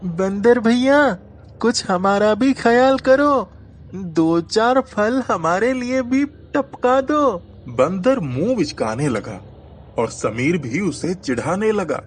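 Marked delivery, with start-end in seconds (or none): 0.0-7.4 put-on coaxing young boy. A narrator's reading. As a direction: sweet-talk the monkey for fruit. Boy pleading with monkey.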